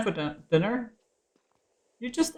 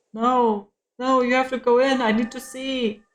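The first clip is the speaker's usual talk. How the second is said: louder and higher, begging the game; exasperated pleading; frustrated whining; 6 semitones higher, 4 dB louder than usual. Real, not acted. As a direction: whine at the screen in frustration.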